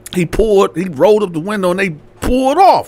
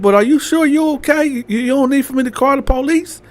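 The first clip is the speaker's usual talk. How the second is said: higher-pitched careful questioning; acted concern; 8 semitones higher than usual. Landlord offering to call the police, concerned. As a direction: gently check on someone, pitch lifting. Landlord checks on hurt girl.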